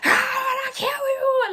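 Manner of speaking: high, loud mock shriek; crashing out. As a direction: shriek it in an acted-out frenzy. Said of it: "she's just like".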